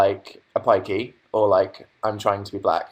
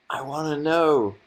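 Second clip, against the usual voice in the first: "know" stretched, higher and slow; sing-song repeat; 4 semitones higher than usual. Shown as a demonstration. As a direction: sing it out slowly, pleading a bit.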